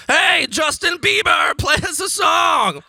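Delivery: put-on high, slow excited kid shout. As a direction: call out like eager children mobbing you. Kids running up shouting request.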